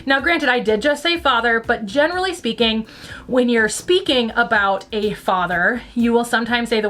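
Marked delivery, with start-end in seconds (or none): none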